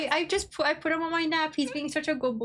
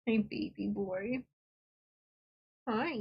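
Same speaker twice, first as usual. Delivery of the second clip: soft pet talk; 3 semitones lower, 8 dB quieter, slower than usual. Real, not acted. Cooing at her cuddling pet.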